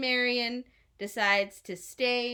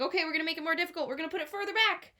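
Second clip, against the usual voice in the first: rushed officials; 6 semitones higher, much faster than usual. A narrator's reading. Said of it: "they're like".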